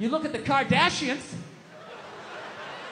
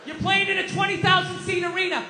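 yelling at the TV; 5 semitones higher than usual. Retold or imitated. Fan at home screaming at the TV, exasperated yell. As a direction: shout at the screen like a fed-up fan. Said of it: "screaming at the T V".

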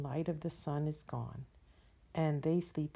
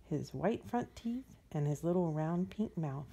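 plain reading voice

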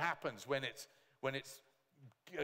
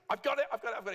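high, louder triumphant repeat; excited cry; 9 semitones higher, 7 dB louder, much faster than usual. Retold or imitated. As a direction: shout it like a man who found treasure. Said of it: "He was absolutely convinced".

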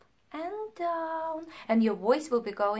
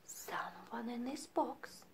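whispered secret; 3 semitones lower, 13 dB quieter, slower than usual. Acted. Teacher whispering to children about sleeping teddies. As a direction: hush it, someone's sleeping.

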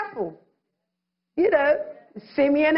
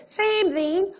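ordinary delivery

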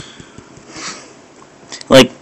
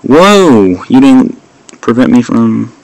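normal talking voice

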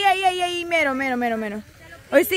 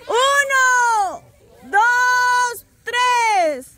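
shouted countdown; 6 semitones higher, much slower than usual. Real, not acted. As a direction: call the count out to the room. Host counting down a game start.

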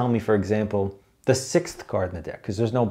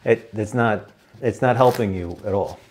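usual speaking voice

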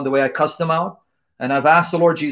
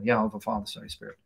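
softer, lower, almost whispered prayer; hushed prayer; 7 semitones lower, 12 dB quieter, faster than usual. Acted. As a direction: let the prayer fall to a breath. Prayer sinks to a hush.

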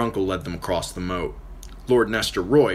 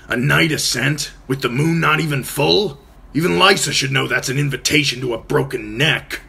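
blunt objection; 8 semitones higher, 6 dB louder than usual. Acted. Uncle objects to night climb.

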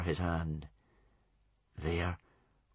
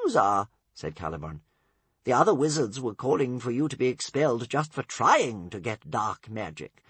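higher, mounting stern list; 10 semitones higher, 4 dB louder than usual. A narrator's reading. Caliburn listing Tsar's crimes, stern and rising. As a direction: hammer each forbidden.

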